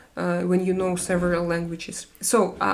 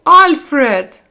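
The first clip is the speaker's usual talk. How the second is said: loud, high excited call; thrilled, booming exclamation; delighted shout; 5 semitones higher, 12 dB louder than usual. Real, not acted. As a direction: cry out the name in delight. Delighted call to a gift giver.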